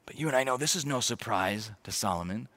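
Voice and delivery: usual speaking voice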